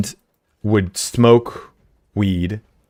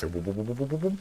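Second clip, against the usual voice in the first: soft boop sounds; 15 dB quieter than usual. Shown as a demonstration. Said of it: "Like, it's going like this".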